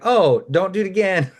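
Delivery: high-pitched, lightly mock scolding; imitated forgiving shopkeepers. Shop owners letting a young thief off, lightly mock scolding. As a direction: put on a high, indulgent shopkeeper voice.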